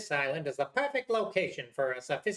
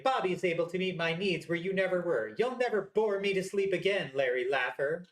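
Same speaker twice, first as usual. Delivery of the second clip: ordinary talk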